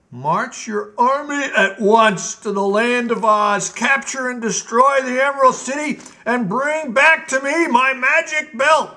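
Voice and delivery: high, loud, greedy royal whine; Gnome King's tantrum. Acted. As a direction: stamp and demand it like a spoilt tyrant king. King orders the march.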